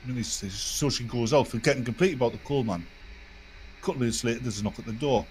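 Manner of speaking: ordinary delivery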